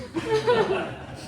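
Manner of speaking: high-pitched laughter; self-amused laugh. Acted. Laughs at his own boast.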